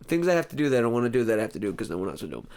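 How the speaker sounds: very fast recap, words run together; rapid-fire rattle. Pretend. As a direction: race through it without pausing. Rattles off the repeated topic again.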